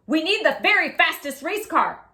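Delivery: loud excited race official; eager committee voice. Acted. Committee member urging.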